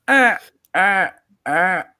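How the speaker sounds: high, loud strangled screech; creepy vulture croak. Pretend. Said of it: "You sound like a dying vulture".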